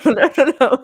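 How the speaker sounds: loud, high no's through a laugh; laughing protest. Real, not acted. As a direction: protest while cracking up.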